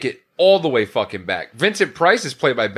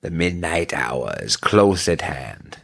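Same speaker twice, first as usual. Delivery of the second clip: slow, eerie horror-movie narrator; spooky recitation; 6 semitones lower, slower than usual. Retold or imitated. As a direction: intone it like a creepy movie trailer. Reciting [name] line, spooky.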